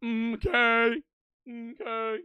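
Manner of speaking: sad, nervous cartoon catchphrase; Mister Mackey. Streamer mocking how the character sounds, nervous impression. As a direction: imitate a nervous character's hummed verbal tic. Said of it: "like, sad and nervous all the time".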